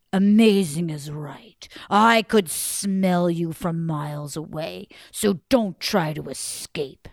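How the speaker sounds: low, slow, menacing growl; menacing giant. Acted. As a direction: threaten him low and slow.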